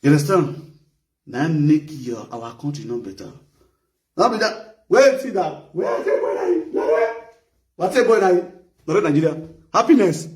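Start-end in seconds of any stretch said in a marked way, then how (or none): 4.2-10.3 mock excited calling.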